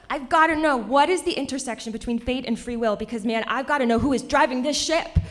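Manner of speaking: loud, high, urgent pleading question; so excited. Retold or imitated. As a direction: demand the answer with hammed-up excitement. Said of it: "I get so excited".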